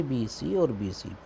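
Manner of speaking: ordinary talk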